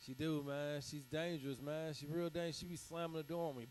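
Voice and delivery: normal talking voice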